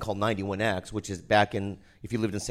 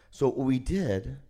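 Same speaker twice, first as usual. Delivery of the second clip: ordinary talk, nothing marked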